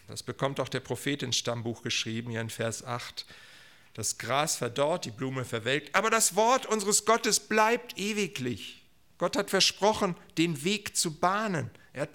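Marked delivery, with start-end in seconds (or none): 5.9-11.7 higher, ringing proclamation; emphatic preaching. Real, not acted. Preacher proclaims God's enduring word, emphatically. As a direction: declare it as a firm promise.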